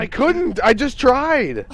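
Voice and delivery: flustered. Real, not acted. Defending after being caught.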